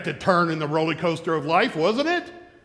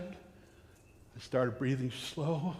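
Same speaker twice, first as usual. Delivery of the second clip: normal talking voice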